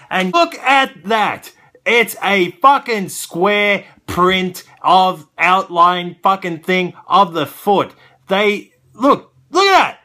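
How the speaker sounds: loud, high, furious ranting; angry rant. Real, not acted. Collector ranting at a cheap toy, loud and furious.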